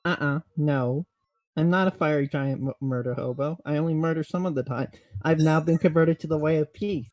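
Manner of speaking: ordinary talk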